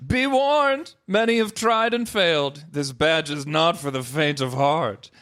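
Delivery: high dramatic warning, slowing down; ominous warning. Acted. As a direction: warn them like a proud master. Gym leader warns Ash.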